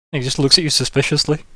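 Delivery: ordinary delivery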